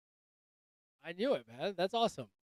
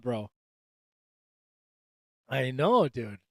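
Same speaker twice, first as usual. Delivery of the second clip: ordinary talk, nothing marked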